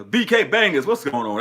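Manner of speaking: loud, high, excited call; excited shout-out. Real, not acted. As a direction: hype up the name.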